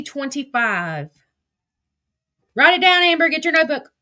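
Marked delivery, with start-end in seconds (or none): 2.5-3.9 louder and higher, teasing Amber to take notes; louder teasing order to write it down; playful call-out. Real, not acted. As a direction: bark it like a teacher at Amber. Calling out to Amber.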